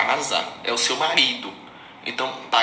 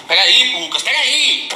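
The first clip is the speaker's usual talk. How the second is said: loud call across the house, higher; 9 semitones higher, 7 dB louder, slower than usual. Real, not acted. Calling to her husband Lucas in another room, loud. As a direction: shout for Lucas to wait.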